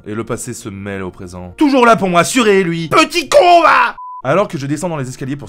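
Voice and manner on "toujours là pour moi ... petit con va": loud high mocking insult; sarcastic outburst. Mocking Nicolas for his advice, loud. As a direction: spit it out sarcastically.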